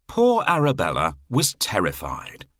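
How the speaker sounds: plain reading voice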